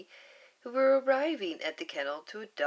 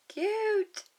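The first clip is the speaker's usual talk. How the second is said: much higher delighted squeal; cute reaction; 4 dB louder than usual. Real, not acted. Reader reacting to the story, delighted and high. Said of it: "reader's own reaction".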